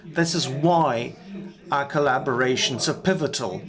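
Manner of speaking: normal talking voice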